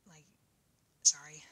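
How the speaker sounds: usual speaking voice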